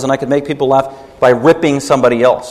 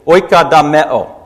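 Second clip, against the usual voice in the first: ordinary talk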